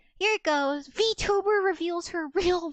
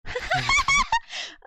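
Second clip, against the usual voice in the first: high, loud squealing laugh; shrieking laugh; 5 dB louder than usual. Real, not acted. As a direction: burst out in a shrill cackle. Laughing at own old voice.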